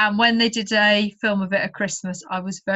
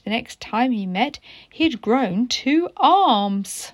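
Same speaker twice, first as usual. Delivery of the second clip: storybook read-aloud voice; story narration; slower than usual. A narrator's reading. Teacher reading a story to pupils, gentle storytelling. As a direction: read the picture book to children.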